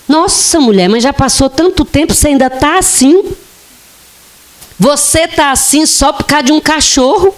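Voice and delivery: high, fast, incredulous scolding; mock the tactless friend who can't believe she still grieves; insensitive friend. A friend scolding a grieving widow, incredulous and high.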